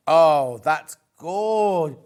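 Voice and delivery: suppressing hiccups. Real, not acted. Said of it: "Oh, I've got hiccups".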